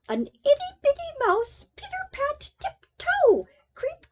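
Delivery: high tiny voice. A narrator's reading. Narrator voicing tiny mouse.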